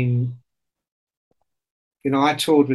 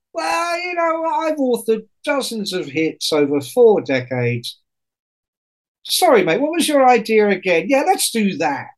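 smug rock star; 8 semitones higher than usual. Retold or imitated. Voicing a rich rock star.

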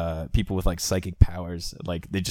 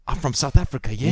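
higher-pitched hammed-up South African; South African accent; 5 semitones higher, faster than usual. Playing the new movie hero, South African accent. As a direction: announce yourself in mock South African, rising "yeah". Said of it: "It's going to be".

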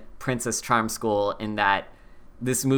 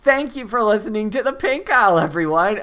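high sign-off; 9 semitones higher, 4 dB louder than usual. Pretend. Abrupt sign-off after joke.

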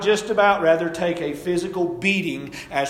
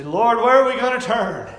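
pleading cry; 7 semitones higher, 9 dB louder than usual. Retold or imitated. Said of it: "we say".

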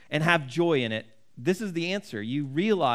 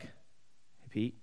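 gentle whisper; 5 semitones lower, 14 dB quieter than usual. Retold or imitated. Jesus greets Peter on walk.

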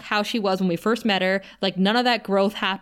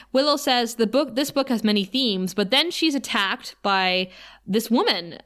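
ordinary delivery

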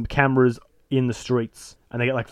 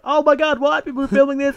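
very high, excited disbelief; mock shock; 13 semitones higher than usual. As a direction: squeal in hammed-up amazement. Acting victim discovering cameras.

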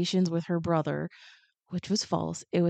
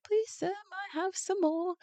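high, pleading little orphan voice; a hungry boy asking the kind sister for food; 12 semitones higher, 5 dB quieter than usual. Retold or imitated. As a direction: beg like a starving small boy. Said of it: "little boy's like".

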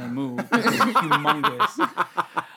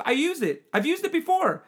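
high mock voice; 11 semitones higher than usual. Friend quoted, high voice.

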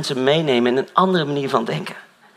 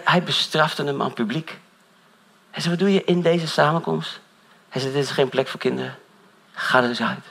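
usual speaking voice

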